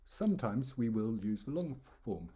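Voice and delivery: ordinary talk, nothing marked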